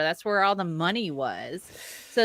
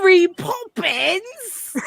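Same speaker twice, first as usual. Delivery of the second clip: bad Cockney accent; 10 semitones higher, 7 dB louder, slower than usual. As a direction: stretch Mary Poppins out in a mock Cockney accent, higher.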